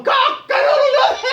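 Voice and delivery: very high, loud cock-a-doodle-doo; crowing rooster. Acted. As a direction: crow like a bedraggled rooster. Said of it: "his head popped back going".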